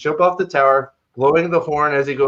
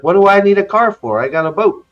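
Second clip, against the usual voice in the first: higher, louder, incredulous comeback; mock indignant protest; 7 semitones higher, 6 dB louder than usual. [name] objects to Ishmael selling him a car, mock indignant. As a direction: protest as if it's absurd.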